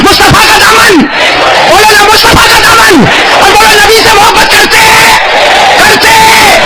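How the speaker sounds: high shouted call; call and response. Acted. Preacher calling out lines for the crowd, high shout. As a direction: shout each phrase for the crowd to echo.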